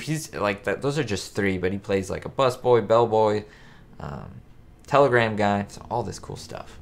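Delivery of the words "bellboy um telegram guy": usual speaking voice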